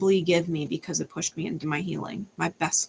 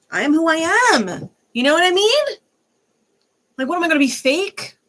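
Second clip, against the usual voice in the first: much higher and louder, exasperated; defiant high outburst; 9 semitones higher, 5 dB louder than usual. Real, not acted. Vlogger defending her cussing to viewers, exasperated high outburst. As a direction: fling it out, fed up and proud.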